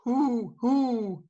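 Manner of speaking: high owl hoo; owl hoot. Shown as a demonstration. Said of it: "Then the owl".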